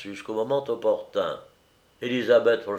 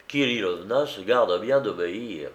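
plain reading voice

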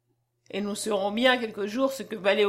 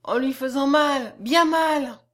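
put-on higher, louder child's musing; thinking aloud; 4 semitones higher, 5 dB louder, slower than usual. A narrator's reading. Little girl planning to wake him, thinking aloud. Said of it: "pensa-t-elle tout haut".

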